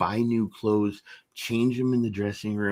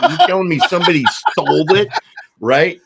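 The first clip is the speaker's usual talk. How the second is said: louder, higher, laughing through the question; laughing disbelief, much louder; 8 semitones higher, 11 dB louder than usual. Real, not acted. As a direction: crack up at the absurdity. Retold call to the boss.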